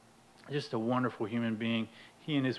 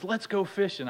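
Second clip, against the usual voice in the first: lightly eager, higher-pitched proposal; eager suggestion; 7 semitones higher than usual. Retold or imitated. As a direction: float it brightly as a plan. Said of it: "Dave says".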